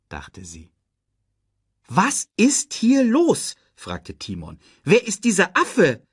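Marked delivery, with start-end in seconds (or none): 1.9-3.6 high, loud, drawn-out question; baffled. A narrator's reading. Timon asks what is going on, baffled. 4.8-6.1 high, loud puzzled question; baffled. A narrator's reading. Timon asks about the strange monkey, baffled.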